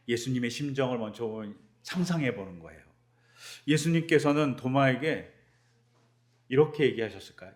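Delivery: ordinary talk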